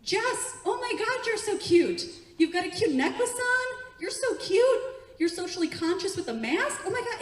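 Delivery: high, loud squealing admiration; shower them with excited praise; over-the-top adoring gush; gushing compliments. Shown as a demonstration. Gushes compliments at audience member.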